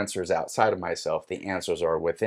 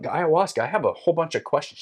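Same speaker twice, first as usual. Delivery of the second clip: higher, quick, eager address; eager questioning; 7 semitones higher, faster than usual. Retold or imitated. Seeker addressing ayahuasca with questions, eagerly. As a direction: reenact an eager appeal, pitched up and quick.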